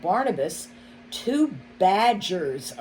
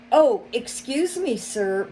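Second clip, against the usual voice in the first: startled, higher apology from the mole; apologetic old mole; 5 semitones higher, 4 dB louder than usual. A narrator's reading. Mole apologizing to the fox, startled and higher. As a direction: blurt out a sorry as honey spills. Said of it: "Barnabas apologized".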